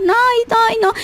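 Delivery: high-pitched acted refusal; refusing to talk. Person who won't talk waving off questions, refusing. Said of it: "definitivamente no quieren hablar".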